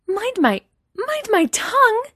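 very high, loud, indignant sputter; sputtering outrage. A narrator's reading. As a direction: break off and restart, scandalised. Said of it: "Leia sputters".

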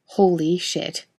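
ordinary delivery

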